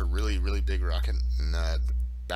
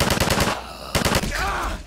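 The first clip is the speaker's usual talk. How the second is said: very high, slow pleading voice; tiny plaintive voice. Acted. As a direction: whimper it like a small creature.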